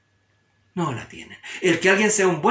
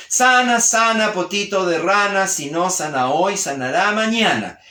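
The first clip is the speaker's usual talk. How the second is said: higher, rhythmic sing-song chant; mother's healing rhyme; 3 semitones higher, 4 dB louder than usual. Retold or imitated. Mother to her hurt child, soothing chanted rhyme. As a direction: imitate a mother chanting over a sore tummy. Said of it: "El niño que llega llorando donde la mamá".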